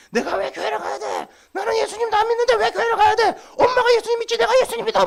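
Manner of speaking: put-on high, rising into a loud teen protest; whiny defiant teen. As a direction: play the stubborn kid yelling back at mom. Defiant teen quoted arguing with mother.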